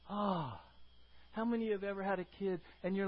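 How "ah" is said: usual speaking voice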